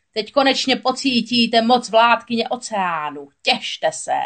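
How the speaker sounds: loud, slow furious threat; menacing threat. A narrator's reading. Towering witch threatening the lovers, loud and slow. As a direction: loom over them and promise doom.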